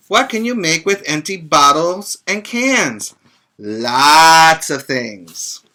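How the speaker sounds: put-on lively read-aloud for little kids, a bit slow in places; reading to children. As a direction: read it out warmly to a group of children. Reads picture book to children.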